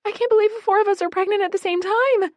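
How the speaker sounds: very high, fast, teary; crying with joy over shared news; tearful joy. A narrator's reading. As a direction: gush through happy tears. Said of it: "Abby cries, tears streaming".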